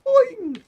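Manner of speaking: high, loud, springy boing; "boing" jump noise. Real, not acted. Gamer adding a sound effect to play, high boing. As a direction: squeal a springy cartoon boing.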